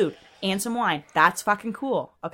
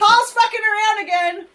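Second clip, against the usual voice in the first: tattletale yell; 12 semitones higher, 8 dB louder than usual. Acted. Tattling to Jesus loudly.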